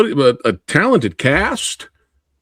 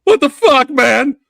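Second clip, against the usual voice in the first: high, loud, fed-up tough-guy voice; impatient Scott; 11 semitones higher, 5 dB louder than usual. Retold or imitated. Acting out Scott's character demanding his money, angry and loud. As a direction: snap it out like a greedy thug. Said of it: "you got Scott over here going".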